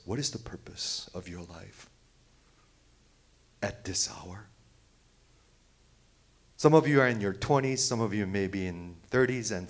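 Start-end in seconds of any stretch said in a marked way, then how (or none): 0.0-4.5 hushed, softer, lower questioning whisper; intense whisper. Real, not acted. Preacher asking congregation their life purpose, whispered.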